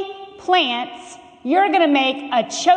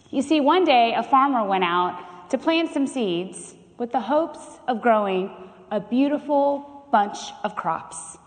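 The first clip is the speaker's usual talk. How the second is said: usual speaking voice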